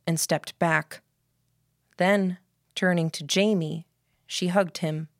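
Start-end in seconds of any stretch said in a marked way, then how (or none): none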